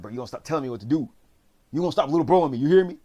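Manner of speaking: fast, building to a high, loud challenge; acted angry confrontation. Bumsuck telling Suho off, acted angry outburst.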